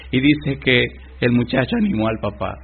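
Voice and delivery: ordinary talk, nothing marked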